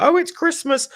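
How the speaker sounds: high-pitched mocking squeal; mocking excuse-makers. Fan quoting excuses for empty seats, high mockery. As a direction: mimic a feeble excuse way up high. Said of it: "trying to give excuses about".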